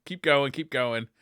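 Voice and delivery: ordinary delivery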